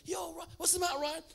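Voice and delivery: high, loud mock call after someone. Dad calling after Ryan in the corridor, re-enacted calling out.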